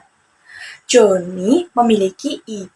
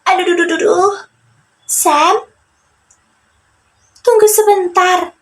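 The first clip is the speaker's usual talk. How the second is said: higher, whiny voice of a hurt friend; pained little character voice; Joni in pain; 9 semitones higher than usual. Acted. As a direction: groan and beg for a wait. Story figure Joni in pain.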